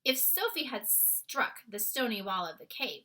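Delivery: usual speaking voice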